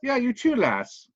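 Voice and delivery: a lightly put-on Irish accent, dismissive; Irish VIP brushing off a reporter, in an Irish accent. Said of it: "I got a shitty accent today".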